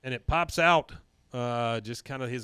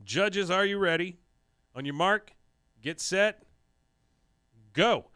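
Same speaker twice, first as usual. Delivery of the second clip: raised, measured announcer calls; lightly voiced contest official, higher pitched; referee; 5 semitones higher than usual. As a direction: start the contest like a referee, with pauses. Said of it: "we hear the referee say".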